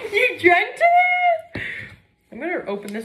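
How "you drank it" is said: high shocked laugh. Real, not acted. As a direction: squeal it in disbelief.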